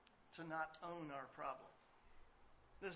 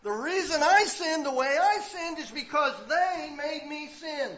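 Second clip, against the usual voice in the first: whiny excuse-maker; 12 semitones higher, 24 dB louder, slower than usual. Retold or imitated. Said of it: "blamed your problem on somebody else".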